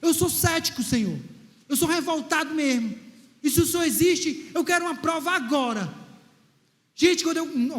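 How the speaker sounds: usual speaking voice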